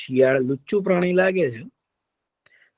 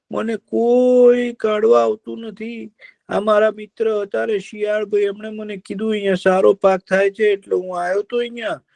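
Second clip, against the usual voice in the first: high, plaintive deer pouring out his trouble; a stuck deer complaining to his friend; sad deer; 10 semitones higher than usual. Acted. Deer laments to crow. (ઊંચા અવાજે દુઃખી હરણની ફરિયાદ)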